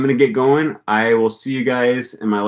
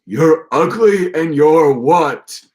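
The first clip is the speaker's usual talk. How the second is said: slow, higher goofy Patrick voice, a bit louder; imitating Patrick; 6 semitones higher, 5 dB louder, much slower than usual. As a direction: drag it out like a teasing cartoon starfish. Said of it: "And then Patrick goes".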